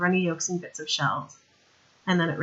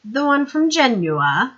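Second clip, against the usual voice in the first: higher, louder sneer, drawn out; sneering, slow; 6 semitones higher, 7 dB louder, slower than usual. Acted. Lord Winder mocking Madame's origin, slow sneer. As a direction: let the scorn drag.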